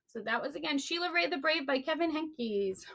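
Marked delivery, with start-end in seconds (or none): none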